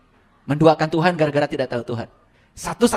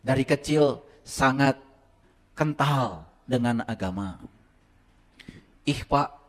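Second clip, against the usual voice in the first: usual speaking voice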